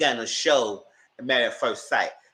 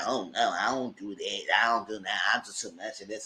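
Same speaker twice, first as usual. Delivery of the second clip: a hint of a prissy, lower, softer mock; 4 semitones lower, 6 dB quieter than usual. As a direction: lightly play her as a fussy prude. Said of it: "She was like".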